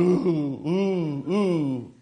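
mournful ghostly moan. Retold or imitated. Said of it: "they were going".

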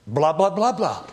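mock Arabic. Preacher spoofing the name in Arabic for the congregation, mock Arabic. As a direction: mimic a foreign tongue in nonsense.

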